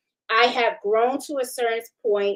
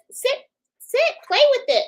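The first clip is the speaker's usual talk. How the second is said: shop owner urging; 14 semitones higher, 3 dB louder than usual. Retold or imitated. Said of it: "the owner of the shop says".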